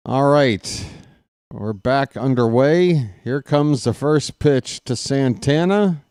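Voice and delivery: baseball announcer building tension; play-by-play call. Acted. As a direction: call each pitch like a radio broadcaster, growing keyed up. Announcer calling a ball game.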